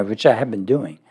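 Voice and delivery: ordinary talk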